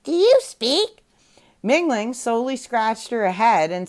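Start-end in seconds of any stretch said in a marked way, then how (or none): 0.0-1.0 high, slow squawky parrot mimic. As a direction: copy her like a parrot, pitched way up. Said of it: "The parrot ... said".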